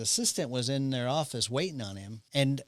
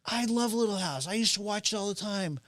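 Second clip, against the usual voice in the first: gushing fan; 6 semitones higher than usual. Retold or imitated. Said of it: "she's like".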